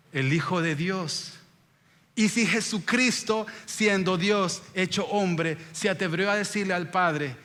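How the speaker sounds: ordinary delivery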